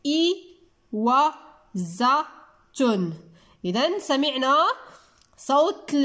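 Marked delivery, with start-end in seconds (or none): 0.1-3.1 إي و ز تن in separate syllables, clear pauses between; slow teacher's breakdown of إي و ز تن, each syllable isolated. Shown as a demonstration. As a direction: sound out إي و ز تن one syllable at a time. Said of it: "واسمعوا جيدا".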